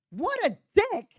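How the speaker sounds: high, contemptuous sneer; high scornful jab; scornful aside. Real, not acted. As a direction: spit it out in disgust.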